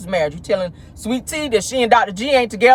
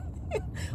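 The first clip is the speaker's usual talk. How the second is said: high laugh; 18 dB quieter than usual. Real, not acted. Laughing at Keke's rambling.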